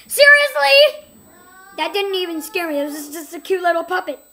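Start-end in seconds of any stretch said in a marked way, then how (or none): 0.1-1.1 indignant. Real, not acted. Indignant at game.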